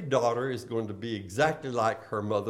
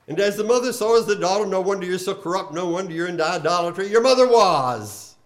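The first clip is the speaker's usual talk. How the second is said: higher, louder preaching of God's accusation; forceful rebuke; 5 semitones higher, 7 dB louder, faster than usual. Acted. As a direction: thunder the rebuke at a sinful city.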